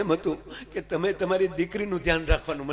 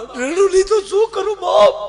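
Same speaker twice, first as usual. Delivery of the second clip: high, louder blubbering son-in-law; mock crying; 9 dB louder than usual. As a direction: wail it like a comic crybaby. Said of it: "પણ કુમાર તમે રડો માં". (રડતો રડતો જમાઈ)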